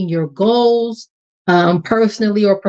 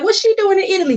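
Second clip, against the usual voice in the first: nosy; 12 semitones higher, faster than usual. Retold or imitated.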